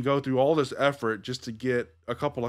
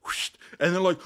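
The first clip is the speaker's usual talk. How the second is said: normal talking voice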